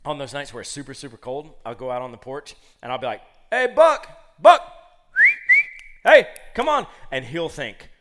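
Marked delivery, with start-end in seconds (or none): 3.5-6.9 high, loud summoning shout; calling the dog. Retold or imitated. Owner calling dog from porch, hollering. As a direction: holler for a dog across the yard.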